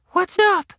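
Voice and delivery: put-on very high little-girl voice; high squeaky kid question; young girl asking. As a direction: ask it in a tiny child's pitch. Taylor questions the scene.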